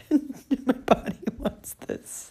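high, slow, choked-up laugh-cry; real teary voice, drawn out and breaking; tearful. Real, not acted. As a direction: squeeze the words out through tears. Still crying as she talks.

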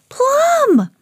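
very high, alarmed call; high-pitched storybook holler; crying out. A narrator's reading. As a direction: yell the dog's name to stop it. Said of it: "cried Emma".

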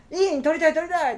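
high, loud eager squeal; excited. Retold or imitated. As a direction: jump at the chance. His eager reply retold.

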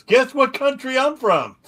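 high, loud shouted stage opener; stage opening line. Retold or imitated. Drew Carey walks out on stage, high loud shout. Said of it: "I remember his opening line".

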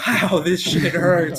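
slow, dragged-out complaint; slow retold moan about the pain; pained drawl. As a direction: stretch it out while still hurting. Sitting in pain after stings.

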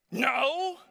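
put-on loud high childish no; shouted no. Son flatly refusing his parents' lesson, loud shout. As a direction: blurt it out like a defiant kid. Son's blunt refusal in story.

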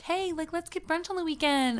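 high, lightly voiced eager friend. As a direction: chirp the invite like a keen friend. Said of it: "somebody hits me up and is like".

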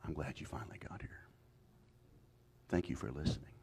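tender unvoiced whisper; the voice of Jesus, whispered tenderly. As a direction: breathe it like a secret blessing. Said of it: "I heard Jesus say".

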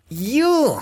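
high, drawn-out "you" like "eww"; exaggerated high yuck on "you"; disgusted. Shown as a demonstration. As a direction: say "you" as if grossed out. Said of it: "les diera asco algo".

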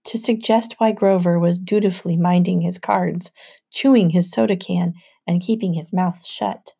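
ordinary delivery, nothing marked

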